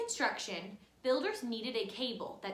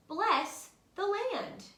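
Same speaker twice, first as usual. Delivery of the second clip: high, slow, solemn blessing voice; kites twisting; 9 semitones higher, 5 dB louder, slower than usual. A narrator's reading. Kites blessing the planting fields, high and slow. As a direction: bless the land from up in the sky. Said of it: "they twist".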